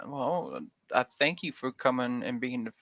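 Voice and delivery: ordinary talk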